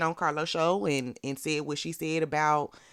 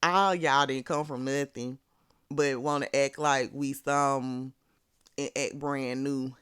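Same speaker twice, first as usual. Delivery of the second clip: ordinary talk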